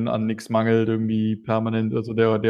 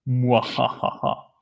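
slow villainous laugh; evil chuckle; much slower than usual. Acted. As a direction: gloat with a drawn-out evil laugh. Villain laugh after good roll.

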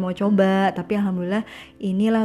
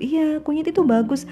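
retold eager assurance; 8 semitones higher than usual. Older woman recommending turmeric to her, imitated assurance. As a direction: imitate a reassuring older woman, high-pitched.

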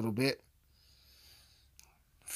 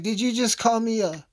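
indignant retort; 8 semitones higher, 6 dB louder than usual. Retold or imitated. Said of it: "And I was like".

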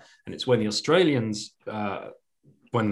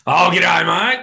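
big, loud, high-pitched Aussie greeting; Australian accent; 7 semitones higher, 8 dB louder, slower than usual. Shown as a demonstration. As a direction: call it out in broad Australian. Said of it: "we can do a good Aussie accent".

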